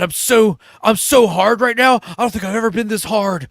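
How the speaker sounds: comically overeager lover, voice pushed up; mock aroused excitement. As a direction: act out breathless-with-excitement boasting. Retold comic sex scene.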